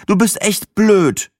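high, slow, drawn-out indignation; indignant outburst. Acted. Sina fooled by the narrator's joke, indignant. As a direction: scold him slowly in a high voice.

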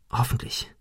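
soft whisper to oneself; hushed, voiceless inner hope; whispered thought. Acted. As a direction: whisper it secretly from hiding. Hidden boy's anxious thought.

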